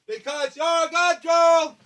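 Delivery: high, slow singsong dog praise; high pet-owner voice; praising the dog. Real, not acted. Praising the puppy as he returns.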